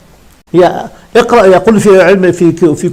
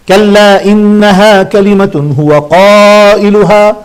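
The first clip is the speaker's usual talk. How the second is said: slow Quran recitation; much slower than usual. Acted. Sheikh recites verse to students, slow chanted recitation. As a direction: draw out كلا إنها كلمة هو قائلها slowly in recitation tune.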